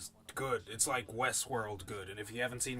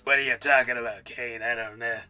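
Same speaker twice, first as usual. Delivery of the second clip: chain-smoker voice; 3 semitones lower, 11 dB louder than usual. Retold or imitated. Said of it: "Sounds like she fucking chain smokes".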